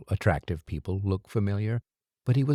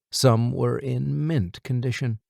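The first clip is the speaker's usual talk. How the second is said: usual speaking voice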